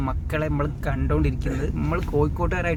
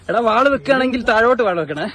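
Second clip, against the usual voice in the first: calling out; 6 semitones higher, 8 dB louder than usual. Real, not acted. Calling out to the rider.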